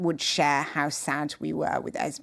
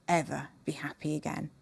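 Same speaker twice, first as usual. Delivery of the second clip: whisper; 8 dB quieter, faster than usual. Retold or imitated. Grieving daughter's words retold.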